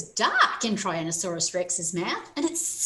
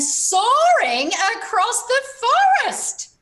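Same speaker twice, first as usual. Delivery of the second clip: excited narration; 13 semitones higher, 9 dB louder than usual. A narrator's reading.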